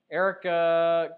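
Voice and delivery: loud, slow stretched call of Eric; drawn-out greeting. Real, not acted. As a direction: draw out Eric loudly to greet him. Greeting caller Eric by name.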